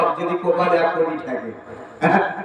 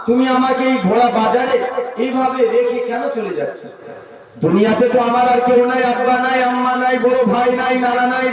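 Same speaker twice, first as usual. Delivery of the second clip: put-on high, chanted lament of an abandoned child; tearful pleading child; 7 semitones higher than usual. Child begging mother not to leave the bazaar, tearful pleading. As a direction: plead in a raised, wailing sing-song. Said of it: "বাচ্চাটা কেঁদে কেঁদে বলছেন".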